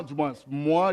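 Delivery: ordinary delivery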